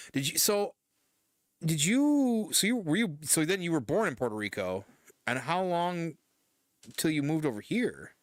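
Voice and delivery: real surprised questions, high and loud. Real, not acted. Startled to learn of Puerto Rico.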